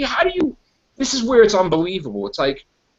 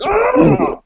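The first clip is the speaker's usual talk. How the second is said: witch scream; 4 dB louder than usual. Retold or imitated.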